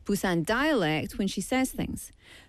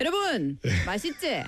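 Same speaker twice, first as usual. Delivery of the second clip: casual Korean regional dialect, not Seoul standard; playful Korean dialect host patter; off-script Korean dialect; much faster than usual. Retold or imitated. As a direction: drop the script and slip into home dialect. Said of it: "if I have to go off script".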